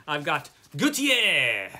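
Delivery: slow, hammed-up French on "Gautier"; a playful stab at a French accent on "Gautier"; mock-French "Gautier", drawn out. Shown as a demonstration. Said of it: "my French accent isn't so good".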